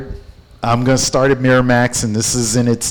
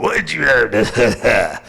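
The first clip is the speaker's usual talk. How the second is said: slow, flat-toned cold question; curt boss; slower than usual. Retold or imitated. Brothers walking in demanding numbers, slow and cold. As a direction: ask the figures without a hello. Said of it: "was like".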